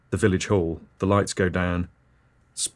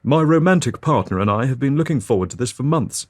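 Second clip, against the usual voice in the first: higher, louder and fast, huffy and prim; indignant rebuke; 4 semitones higher, 4 dB louder, faster than usual. Acted. Woman in front rebuking chatty couple, indignant. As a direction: bristle at the noisy couple behind.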